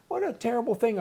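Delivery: ordinary delivery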